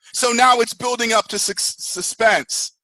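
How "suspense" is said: ordinary talk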